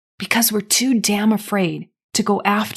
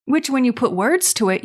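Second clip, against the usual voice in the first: normal talking voice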